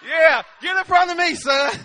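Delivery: loud mock-eager fatherly order; retold comic urging. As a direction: send the boy ahead, loudly.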